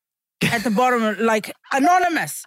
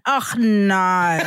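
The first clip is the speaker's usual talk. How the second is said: ordinary talk, nothing marked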